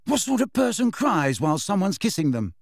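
high, indignant question. A narrator's reading. Ron baffled that Cho cried while kissed, indignant. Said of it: "said Ron indignantly".

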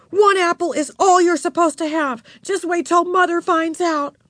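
high, cross scolding; angry. A narrator's reading. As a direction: threaten a little sister with telling Mother. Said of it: "said Beezus angrily, stamping her foot".

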